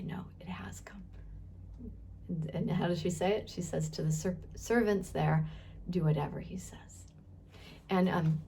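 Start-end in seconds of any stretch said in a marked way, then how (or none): 0.0-1.0 very soft, unvoiced whisper. Mary answering her son, whispered. 5.9-7.1 low soft whisper. Retold or imitated. Mary telling the servants, whispered.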